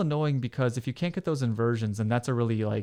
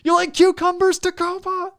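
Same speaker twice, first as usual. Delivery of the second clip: high, loud stage yell; hammy frontman calling the crowd; rock star shout; 7 dB louder than usual. Retold or imitated. Imitating rock star on mic.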